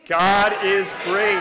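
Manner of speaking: big, slow shout of praise; high, full-volume declaration; loud voice. Shown as a demonstration. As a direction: proclaim it loudly, drawing out each word. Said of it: "say it in a loud voice".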